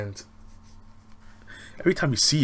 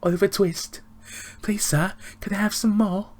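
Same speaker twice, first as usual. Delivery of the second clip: high, loud mock English orphan plea; exaggerated old-fashioned English accent, pitched way up; Oliver Twist English accent; 11 semitones higher, 6 dB louder than usual. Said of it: "Americans seem to think every English person talks like that".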